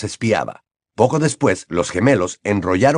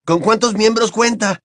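put-on a lightly raised boyish voice; Ron's voice; 11 semitones higher than usual. A narrator's reading. Ron answers Hermione in a light boyish voice. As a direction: play the cheeky teenage boy.

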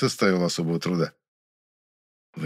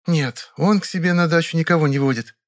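wary Vera; 9 semitones higher, 4 dB louder than usual. A narrator's reading. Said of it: "настороженно оглянувшись".

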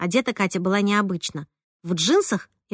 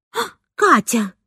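startled cry; 3 semitones higher, 4 dB louder than usual. A narrator's reading. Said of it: "вскрикнула, как ужаленная".

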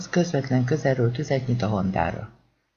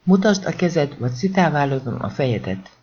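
usual speaking voice

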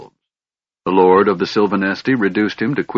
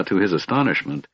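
ordinary delivery, nothing marked

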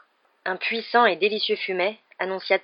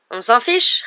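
impatient; 5 semitones higher, 7 dB louder, slower than usual. Acted. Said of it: "répliqua Ron d'un ton impatient".

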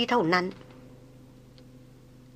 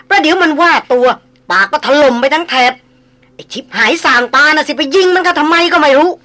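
frantic, breathless, higher and louder; panicked pleading; 6 semitones higher, 6 dB louder than usual. Acted. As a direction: beg the boss to run, in a rush. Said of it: "กระหืดกระหอบลุกลนต่อมา". (ลนลาน กระหืดกระหอบ เสียงสูงดัง)

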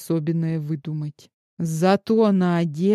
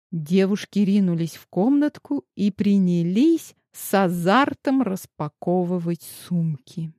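ordinary delivery

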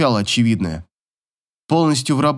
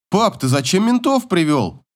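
put-on higher-pitched questioning boy; son's challenge; 6 semitones higher than usual. A narrator's reading. Son questions father about police, higher challenging voice. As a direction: challenge Dad in a raised youthful pitch.

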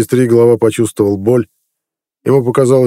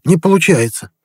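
higher panicked wail on НЕ получается; nervous protest; 8 semitones higher than usual. A narrator's reading. The healer admits failure to the chief, panicked. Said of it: "нервно дёргая глазом".

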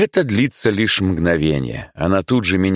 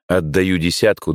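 plain reading voice

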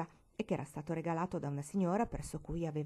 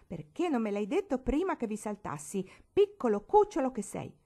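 loud, high, scolding question; irritated; 6 semitones higher, 6 dB louder than usual. A narrator's reading. Barbara scolds Marigold after jumping off the bed, irritated. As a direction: snap at her for not warning. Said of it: "domandò irritata".

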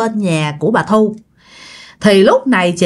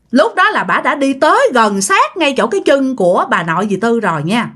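ordinary delivery, nothing marked

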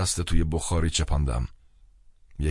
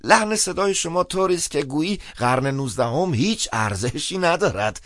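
high-pitched, slightly raised priest voice; speaking up; 11 semitones higher, 3 dB louder than usual. A narrator's reading. Priest notes down the caller's message, speaking up. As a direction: pipe up in a high, lively voice. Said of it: "سپس با صدای بلند گفت".

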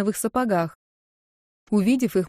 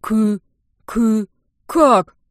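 stuttering; 2 semitones higher, 7 dB louder, much slower than usual. Acted. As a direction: stumble over the first sound in shock. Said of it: "аж начал заикаться мужик".

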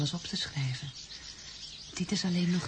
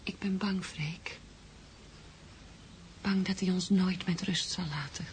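frightened whisper; 6 dB quieter than usual. Acted. Frightened lover whispering her fear.

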